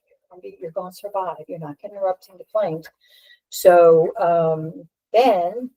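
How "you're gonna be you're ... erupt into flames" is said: soft retold self-reassurance; little inner voice. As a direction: quietly act out the voice talking you through it. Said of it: "the little voice was like".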